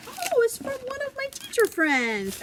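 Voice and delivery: very high, bright delighted exclamation. Retold or imitated. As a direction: gush over the sender. Telling class who sent it.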